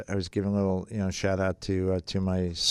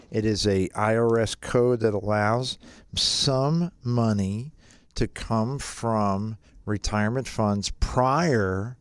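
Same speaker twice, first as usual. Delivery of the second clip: normal talking voice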